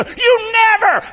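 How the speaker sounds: very high, loud, stretched wail; mock-indignant shriek of accusation; accusing God to his face. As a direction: throw it out high, loud and long. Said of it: "we look in his face and go".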